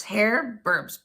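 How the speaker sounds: ordinary delivery, nothing marked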